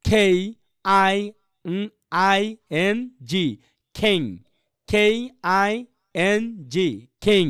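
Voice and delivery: "খেই আই এন জি" slowly, then "খেং"; spelling aloud. Shown as a demonstration. Teacher spells the word out for students, slow demo. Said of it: "এখানে কি বলতে পারি আমরা".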